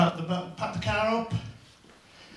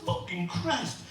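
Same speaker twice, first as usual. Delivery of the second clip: slow, high, shocked swear; shocked exclamation; 11 semitones higher, 4 dB quieter, slower than usual. Retold or imitated. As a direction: react in disbelief at the price. Shocked at the price.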